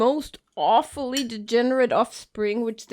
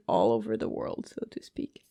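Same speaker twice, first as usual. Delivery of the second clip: unvoiced, sly whispered aside; whispering; 7 dB quieter than usual. Real, not acted. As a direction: whisper the punchline conspiratorially.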